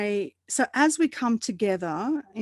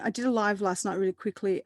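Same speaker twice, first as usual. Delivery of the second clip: ordinary talk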